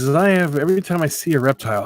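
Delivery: usual speaking voice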